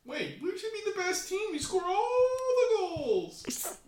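squeaky high cartoon voice; silly high voice. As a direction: play a squeaky little team mascot. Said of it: "that stupid voice".

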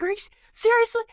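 mock stunned squeak of disbelief; high fast retold surprise; surprised.

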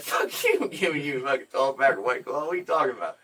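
high, loud mocking refusal; scoffing. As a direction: laugh him off incredulously. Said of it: "I laughed in his face".

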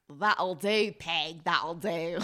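put-on Cockney accent. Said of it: "Your accent is Cockney".

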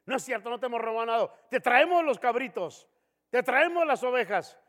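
insist, offended; indignant protest. Retold or imitated. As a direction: people protesting to God, indignant. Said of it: "ellos le dicen".

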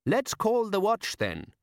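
high, slow, eager suggestion. A narrator's reading. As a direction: pitch it up and slow down. Character suggesting.

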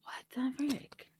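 mock-appalled whisper; barely voiced, playfully aghast whisper; hushed disbelief. Mock-shocked reply to guilt trip.

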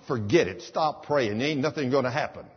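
ordinary talk, nothing marked

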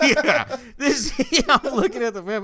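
laughing high and loud through words; can't stop laughing while talking. Real, not acted. Both crack up over drive time.

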